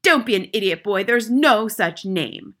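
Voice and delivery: loud, dismissive headmistress; overbearing rebuke; angry scolding. A narrator's reading. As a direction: shout down the pupil, slowing at the end. Headmistress scolding boy over name.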